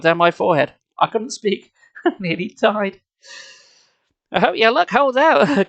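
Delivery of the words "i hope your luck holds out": usual speaking voice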